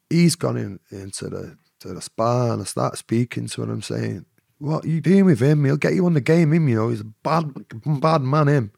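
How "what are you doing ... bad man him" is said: louder, higher urgent plea; urgent warning. Retold or imitated. Man warns girl about pimp, loud urgent plea. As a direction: warn her hard about him.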